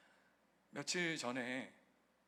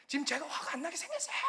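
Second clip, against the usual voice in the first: fast, higher, indignant landlord; angry retort; 12 semitones higher, faster than usual. Retold or imitated. Landlord snapping back at the pastor, mock indignant. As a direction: fire it back in mock outrage. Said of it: "버럭 막 화를 막 내시는 거예요".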